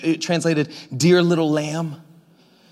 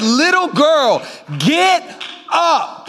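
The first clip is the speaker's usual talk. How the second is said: commanding Jesus; 8 semitones higher, much slower than usual. Acted. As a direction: call the dead girl to rise with authority. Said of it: "And he said".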